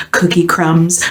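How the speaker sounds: ordinary delivery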